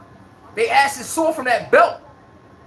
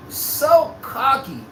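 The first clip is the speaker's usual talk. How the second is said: very slow, stretched so cocky; lingering mock disdain; drawn-out scorn; much slower than usual. Real, not acted. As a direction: stretch every sound of it. Drawn-out emphasis.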